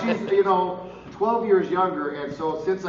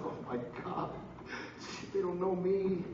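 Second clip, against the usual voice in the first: hushed, much softer aside; dismayed whisper; 2 semitones higher, 10 dB quieter than usual. Retold or imitated. Realizing fans don't recognize him.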